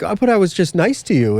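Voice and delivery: lightly hammed-up complaint; mock protest. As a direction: imitate a wounded nice guy. Voicing a rejected nice guy.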